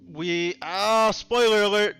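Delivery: high, loud mock-alarm outburst; loud spoiler alert. Real, not acted. Host warns guest of a spoiler, loud exclamation. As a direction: burst out loudly over the talk.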